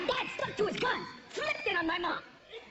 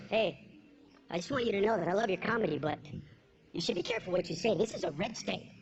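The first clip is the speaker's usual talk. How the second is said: lower, warn him quickly as a local guy; Cleveland guy; 12 semitones lower than usual. Retold or imitated. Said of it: "This guy goes".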